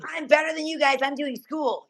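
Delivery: high-pitched snooty boast; mock superior. Teller shuts herself away from the rowdy men, mock superior huff. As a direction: brag it high, mocking yourself. Mocks her own huffy boast.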